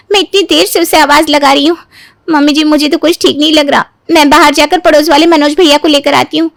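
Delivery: normal talking voice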